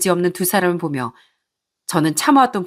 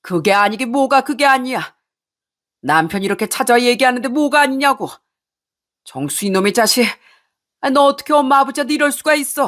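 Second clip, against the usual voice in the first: raging father; 6 semitones higher than usual. Acted. Father rages at his son.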